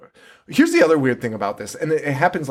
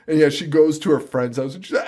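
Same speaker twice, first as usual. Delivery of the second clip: normal talking voice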